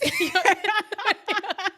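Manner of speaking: loud, high burst of real laughter. Real, not acted. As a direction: crack up loudly. Laughing at doctor's advice.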